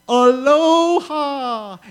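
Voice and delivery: slow, loud, high drawn-out aloha; big woman shouting aloha as she runs; huge aloha. Retold or imitated. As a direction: let it swell like a charging greeting. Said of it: "she says".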